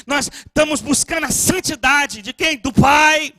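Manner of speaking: ordinary talk, nothing marked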